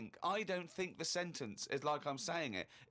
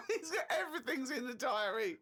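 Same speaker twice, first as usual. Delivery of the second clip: higher voice, breaking with laughter; laughing; 5 semitones higher than usual. Real, not acted. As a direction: say it through real giggles. Laughing at diary's trivial detail.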